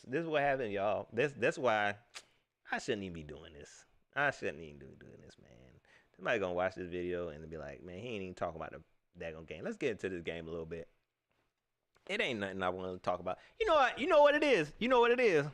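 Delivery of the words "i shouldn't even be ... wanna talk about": low, soft and fast; muttered aside. Real, not acted. Grumbling at himself for going off topic. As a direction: mutter it to yourself.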